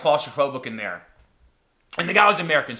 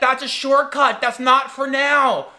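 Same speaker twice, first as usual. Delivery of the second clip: high, raised guide's voice; calling back; 9 semitones higher, 5 dB louder, slower than usual. Retold or imitated. Guide answering the tourist behind him, calling back. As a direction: shout an answer back along a tunnel.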